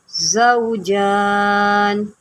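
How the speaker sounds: ज़ौजान with a hissing whistling z; ज़ौजान with a long sharp z; whistled z. Shown as a demonstration. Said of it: "तो इसकी सीटी निकालें".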